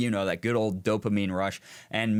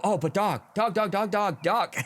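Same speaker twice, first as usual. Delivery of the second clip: high-pitched, scattered dog fixation; distracted excitement; 8 semitones higher than usual. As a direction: blurt it out, suddenly hooked on the dog. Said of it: "a dog walks by and they go".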